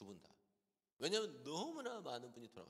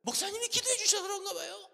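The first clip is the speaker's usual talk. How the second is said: very loud, high-pitched imitation of a delighted patient; hammed-up cheerful phone call; chirpy, beaming voice; 11 dB louder than usual. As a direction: burst with good news, pitched way up. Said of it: "목소리가 생글생글이야".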